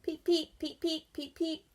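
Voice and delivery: high tiny peep; frog call. Acted. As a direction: chirp like a little frog. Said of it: "a zillion frogs answered".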